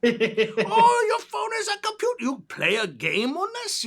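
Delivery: put-on high, slow, amazed old-timer; old-timey voice. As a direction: marvel at it like someone from the 1900s. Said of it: "I'm doing that old-timey voice".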